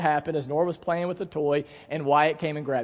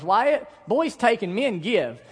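put-on higher, slow little-girl scolding; lightly mimicked girl, drawn out and louder; imitating young daughter; 5 semitones higher, 3 dB louder, slower than usual. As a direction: parrot Dad's lesson as a bossy child. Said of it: "and she goes".

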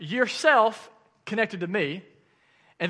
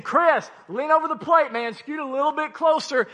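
coach yelling; 6 semitones higher than usual. Retold or imitated.

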